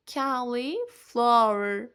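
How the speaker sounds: cauliflower drawn out very slowly, part by part; slow model. Shown as a demonstration. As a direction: stretch cauliflower out for learners to copy. Teacher models the word slowly.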